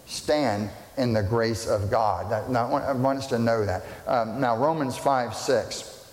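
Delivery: usual speaking voice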